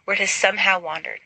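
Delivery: plain reading voice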